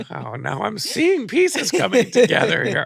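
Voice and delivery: drawn-out ironic wow of realisation; hammed-up sarcastic surprise. Sarcastic reaction to revelation.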